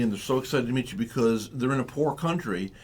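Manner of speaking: normal talking voice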